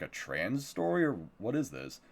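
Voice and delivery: ordinary delivery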